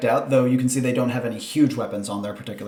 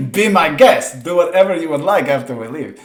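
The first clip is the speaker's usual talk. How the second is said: higher, amused, expansive invitation; breezy permission; 4 semitones higher, 5 dB louder than usual. Acted. Camp leader scoffingly grants players permission.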